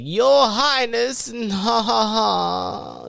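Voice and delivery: mock herald announcing royalty; announcer. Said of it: "he's all like".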